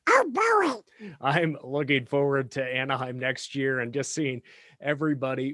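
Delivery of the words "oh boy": very high, loud cartoon duck squawk; Donald Duck. Podcast host jokes about hopes for next year, Donald Duck voice. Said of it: "in my best Donald Duck voice".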